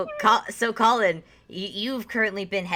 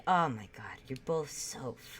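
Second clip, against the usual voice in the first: whispered, fed up; exasperated whisper; 4 semitones lower, 8 dB quieter than usual. Acted. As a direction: breathe it out under breath. Guard exasperated aside.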